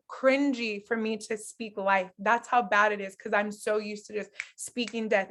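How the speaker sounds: normal talking voice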